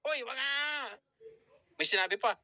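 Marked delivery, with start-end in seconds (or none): 0.1-0.5 high-pitched, hammed-up telling-off; imitating an annoyed objection; mock angry. Angry boyfriend replies to the coach again, mock angry. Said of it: "Nagalit, nag-reply ulit".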